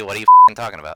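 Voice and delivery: loud fake indignation; mock outraged shout. Mock outrage at own joke.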